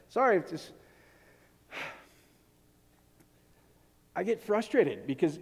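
normal talking voice

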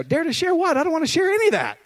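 high, quick whiny refusal; a high-pitched mock whine of dread at being told to share; mock reluctant protest. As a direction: play your younger self balking at sharing. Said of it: "I go".

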